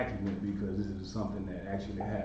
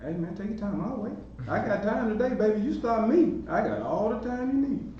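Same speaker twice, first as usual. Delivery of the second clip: high, sweet, overly patient voice; teasing calm, higher pitched; mock patient; 10 semitones higher than usual. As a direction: coax him along, all the time in the world. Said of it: "and I said".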